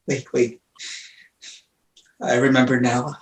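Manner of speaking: very soft, low murmur; hushed murmur. Acted. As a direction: barely voice it. Orestes recollecting weakly.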